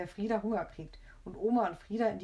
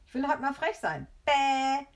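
high, loud bratty defiance; childish back-talk ending in a loud jeer; stroppy; 7 semitones higher, 6 dB louder than usual. A narrator's reading. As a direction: defy grandma like a cheeky child. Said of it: "motzt der Frieder".

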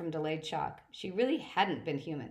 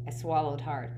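plain reading voice

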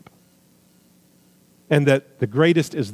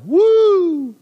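high, loud, drawn-out "woo"; revival whoop; 5 dB louder than usual. Acted. Preacher cheering Philip's revival in Samaria, high whoop.

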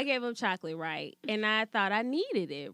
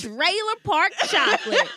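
high, loud laughing punchline; 9 semitones higher, 8 dB louder than usual. Real, not acted. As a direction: crack up on the punchline. Naming the chocolate, laughing.

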